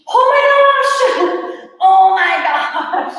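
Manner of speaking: high, slow, laughing groan of effort; strained exclaim. Real, not acted. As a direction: cry out through the burn. Peak of hard exercise.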